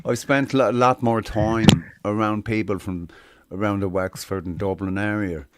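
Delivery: easy, natural Southern Irish accent; flowing Southern Irish accent demo. Shown as a demonstration. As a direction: slip comfortably into a Wexford and Dublin accent. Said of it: "if I'm doing it in the Southern".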